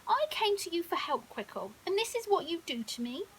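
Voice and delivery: annoyed lion scolding the crocodile. Acted. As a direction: scold a friend who let you down. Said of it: "said a still annoyed Leo".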